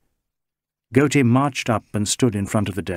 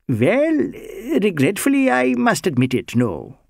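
put-on higher, lilting Indian-accented voice, anxious and earnest; Bengali social worker; 8 semitones higher than usual. Bengali social worker protesting to the inspector, higher Indian-accented voice. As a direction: play the mild Bengali gentleman pleading his innocence.

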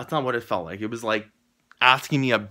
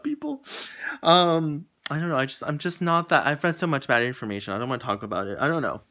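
ordinary talk

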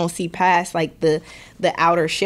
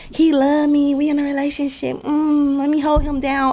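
put-on higher, lovestruck girlfriend voice; smitten girl; 6 semitones higher than usual. Podcaster mimics a naive girlfriend, lovestruck and higher. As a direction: play the devoted girl who's been fooled.

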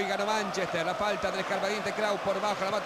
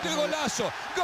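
excited; 8 semitones higher than usual. Real, not acted. Goal exclamation.

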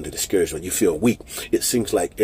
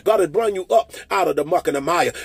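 higher, driving declaration, lines rushing faster toward the end; fervent preaching; 12 semitones higher, 7 dB louder than usual. Acted. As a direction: preach at full force, calling them out of the pain. Fervent declaration over congregation.

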